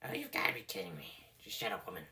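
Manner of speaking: very low, soft grumble; groggy annoyance. Acted. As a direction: grumble it half asleep. Woken from a nap, annoyed.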